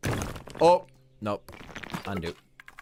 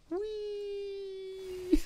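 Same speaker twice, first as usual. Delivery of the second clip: high squeaky sheep voice; cartoon critter squeal; squeaky whee; 4 dB quieter than usual. Voices the sheep being dropped.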